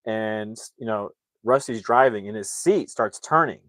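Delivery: usual speaking voice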